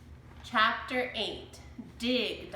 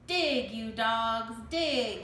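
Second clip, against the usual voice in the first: slow, louder pirate bellow; shouted; 4 dB louder, slower than usual. A narrator's reading. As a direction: bark orders at the crew. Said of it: "he shouted".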